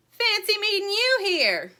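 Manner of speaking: high, loud squirrel voice calling from the tree; squirrel yelling down. A narrator's reading. Squirrel yells down to the chicks in a high, loud voice. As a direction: call down from the branch in a high squirrel voice. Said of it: "Grumpy Squirrel yelled down".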